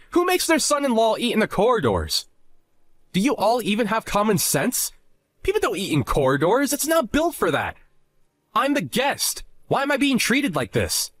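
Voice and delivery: offended, aggrieved arguing; indignant protest. Acted. Husband protests his treatment.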